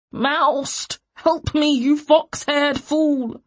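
high, loud, drawn-out yell for help; desperate shout. A narrator's reading. Beaten man to his useless helper, shouting desperately. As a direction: yell furiously for rescue. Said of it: "he shouted thickly".

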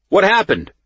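high, loud urgent question; alarmed demand. A narrator's reading. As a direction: call it out like a guard finding bodies. Guard demands explanation after fight.

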